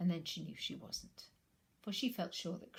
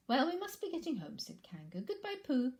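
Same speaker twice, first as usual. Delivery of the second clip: high, louder motherly goodbye; brisk farewell before hopping off; Kanga, cheerful; 8 semitones higher, 5 dB louder than usual. Acted. Kanga leaves.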